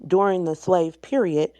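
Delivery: usual speaking voice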